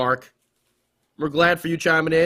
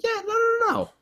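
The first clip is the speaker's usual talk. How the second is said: high squeaky voice. Acted. Arguing with himself, squeaky.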